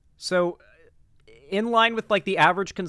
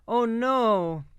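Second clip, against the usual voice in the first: mock pity; 2 semitones higher, slower than usual. Mocking rapists' lost careers with fake sorrowful pity.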